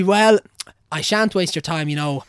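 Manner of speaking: the priest politely taking his leave; mock courteous cleric. Priest voiced saying goodbye.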